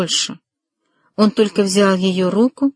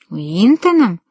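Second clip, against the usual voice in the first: incredulous exclamation, pitch swooping up and back; taken aback, disbelieving question; 4 dB louder, slower than usual. A narrator's reading. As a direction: echo it in disbelief. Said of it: "воскликнул недоверчиво Деннис".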